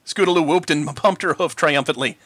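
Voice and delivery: ordinary delivery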